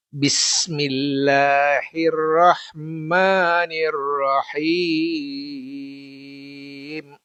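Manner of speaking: very slow, drawn-out chanted recitation. Shown as a demonstration. Teacher repeating the verse for students, slow Quran chant. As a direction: stretch each syllable of Bismillahirrahmanirrahim in a long chant. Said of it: "saya ulangi".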